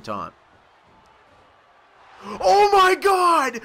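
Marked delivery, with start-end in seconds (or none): 2.2-3.6 high, slow, loud shriek of disbelief; astonished scream. Real, not acted. Player reacting to a brutal hit, astonished scream. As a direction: scream it, stretching every word.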